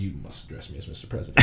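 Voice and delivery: very low, stately mock-presidential voice; Abraham Lincoln. Method actor to intern, low and pompous in character. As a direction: drop deep and play it grave and pompous. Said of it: "it's not an Abraham Lincoln accent".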